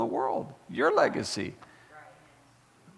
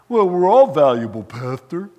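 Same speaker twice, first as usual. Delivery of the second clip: mock objection; 10 dB louder than usual.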